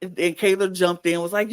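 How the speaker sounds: ordinary talk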